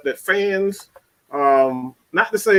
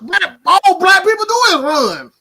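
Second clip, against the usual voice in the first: heated rant; 10 semitones higher than usual. Real, not acted. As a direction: rant hard, getting worked up. Heated rant about Dak.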